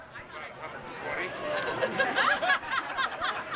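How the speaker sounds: high burst of laughter; laughing. Real, not acted. Audience member laughs at question.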